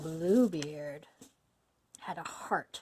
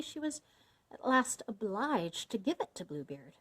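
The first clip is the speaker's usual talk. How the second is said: plain reading voice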